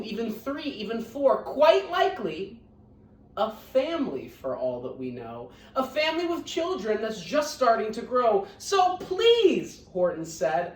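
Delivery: lively, sing-song storybook reading; reading to children. A narrator's reading. Teacher reading Horton Hears a Who to her scholars, lively storybook voice. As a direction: read it out warmly to a class of kids.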